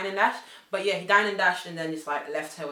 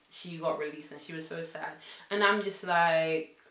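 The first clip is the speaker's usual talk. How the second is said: ordinary talk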